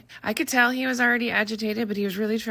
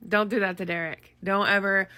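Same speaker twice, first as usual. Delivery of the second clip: usual speaking voice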